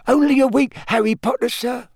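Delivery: happy, very high elf squeak; Dobby. A narrator's reading. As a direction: chirp it out happily, like an eager servant. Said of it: "said Dobby happily".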